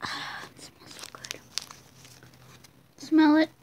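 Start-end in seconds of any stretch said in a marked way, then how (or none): 0.0-1.4 soft breathy whisper. Real, not acted. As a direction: whisper it close to the mic. Whispering while smelling candy.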